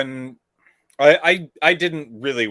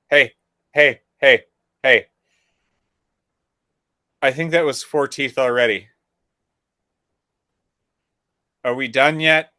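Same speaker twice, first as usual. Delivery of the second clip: louder, insistent hey hey; urgent calling out; 2 semitones higher, 7 dB louder than usual. Retold or imitated. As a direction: tap and call for attention. Retold patting dentist mid-surgery.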